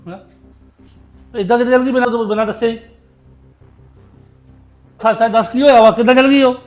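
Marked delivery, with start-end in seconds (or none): 1.4-6.5 loud, low-pitched interrogation; angry demand. Acted. Son questioning mother about his vanished lover, angry demand. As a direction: demand the truth, loudly.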